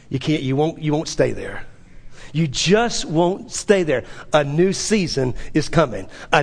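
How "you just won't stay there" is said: ordinary delivery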